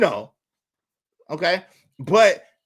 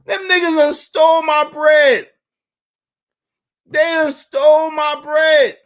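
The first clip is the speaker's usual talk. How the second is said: loud, high outraged shout; heated; 7 semitones higher, 8 dB louder than usual. Retold or imitated. Comedian retelling how the bank took his money, heated shout. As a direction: shout the accusation, pitched way up. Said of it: "So I'm heated at this point".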